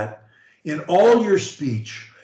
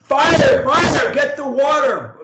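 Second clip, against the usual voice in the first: high, loud panicked shout; alarmed shout; 10 semitones higher, 6 dB louder, slower than usual. Shown as a demonstration. Coach demonstrating an emotion, a panicked fire shout. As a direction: yell it like the house is burning. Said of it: "That's one kind of emotion".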